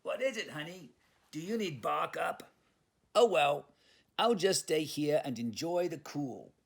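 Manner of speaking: a yappy Brooklyn pup calling out; Brooklyn Chihuahua. Acted. Leon calls after Honey, then stays by the cool air, loud and high. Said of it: "a Chihuahua from Brooklyn".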